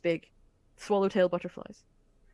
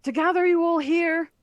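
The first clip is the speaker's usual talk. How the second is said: loud, much higher priestly call; booming announcement; 11 semitones higher, 7 dB louder than usual. Acted. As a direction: call out over the crowd. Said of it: "Father Xanthus goes".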